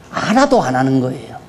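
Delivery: normal talking voice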